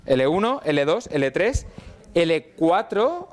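slow, deliberate listing of levels. Shown as a demonstration. Teacher going back over the levels for students, slow and deliberate. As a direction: spell out each level slowly. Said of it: "Lo voy a decir muy rápido".

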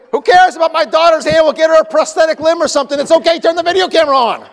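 very high, loud, hammed-up shouting, fast in the middle; comic frantic yell. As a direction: overplay the panicked filmmaker yelling at his wife. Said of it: "I'm saying".

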